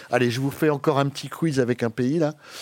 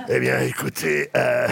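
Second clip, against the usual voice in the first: Chirac impression; 2 semitones lower than usual. Host imitating Chirac on a colleague's request, lightly put-on voice. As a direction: put on a hint of a halting politician. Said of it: "Vas-y, imite Chirac".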